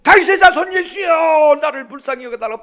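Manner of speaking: acted desperate yell, easing toward the end; crying out. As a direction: shout for mercy across a crowd. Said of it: "막 소리를 지릅니다".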